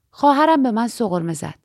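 plain reading voice

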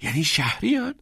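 high, astonished question; almost breathless. A narrator's reading. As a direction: ask as if the breath has nearly gone. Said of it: "تقریباً نفسش بند آمده بود".

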